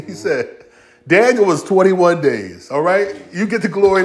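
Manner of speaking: usual speaking voice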